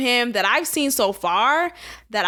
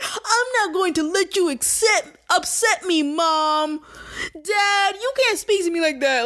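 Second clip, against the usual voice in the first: mock whiny kid; 5 semitones higher than usual. Mocking Charlamagne as whiny child.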